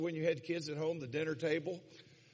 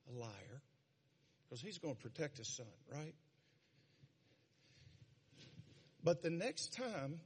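quiet fatherly warning; 3 semitones lower, 9 dB quieter than usual. Retold or imitated.

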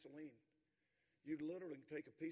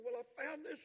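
excited, high and louder; a worked-up kid blurting out his find; boy telling his dad what he found, excited; animated boy; 10 semitones higher, 7 dB louder than usual. Retold or imitated. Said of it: "He's kinda animated. His volume's up".

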